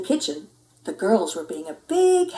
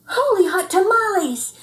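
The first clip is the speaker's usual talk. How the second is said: much higher, louder cry of surprise; excited exclamation; 11 semitones higher, 5 dB louder than usual. A narrator's reading. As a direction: blurt it out amazed. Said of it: "exclaimed Skippy John Jones".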